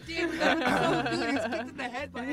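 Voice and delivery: laughing delight after the scene. Real, not acted. Player laughing at a teammate's joke, laughing. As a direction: gush through laughter.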